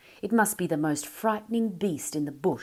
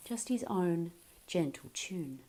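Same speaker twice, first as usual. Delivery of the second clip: ordinary delivery, nothing marked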